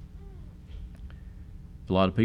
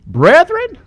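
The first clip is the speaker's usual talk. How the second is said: a much higher, louder, incredulous cry; shocked appeal; 11 dB louder than usual. Acted. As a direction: call out to the brethren in disbelief. Preacher rebukes the congregation.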